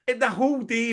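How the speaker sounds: ordinary talk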